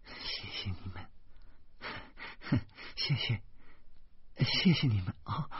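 very soft, breath-heavy thanks; embarrassed thanks. A narrator's reading. Linna thanking the neighbours as she leaves, embarrassed, very soft. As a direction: murmur the thanks, barely voiced.

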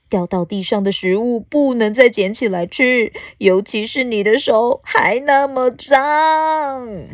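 plain reading voice

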